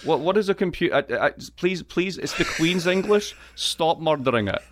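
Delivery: ordinary talk, nothing marked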